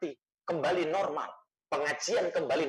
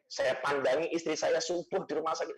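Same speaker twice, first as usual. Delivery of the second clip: ordinary talk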